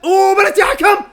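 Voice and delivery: loud, high shout at the referee; shouted appeal. Real, not acted. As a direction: demand the penalty at full voice.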